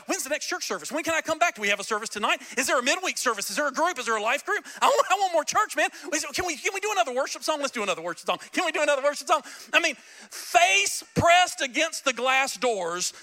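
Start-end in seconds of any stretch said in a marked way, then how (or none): 0.1-9.4 higher, quick eager questions; eager churchgoer. Retold or imitated. Pastor imitating eager converts wanting more services, higher eager voice. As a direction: pester for more church, lightly mocked. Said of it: "we'd be like".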